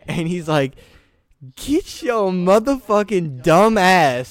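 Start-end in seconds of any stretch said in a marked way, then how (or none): 1.6-4.3 loud, slow, high-pitched mock outrage; hammed-up furious outburst; freaking out. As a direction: draw it out like you're losing it. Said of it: "just start freaking the fuck out".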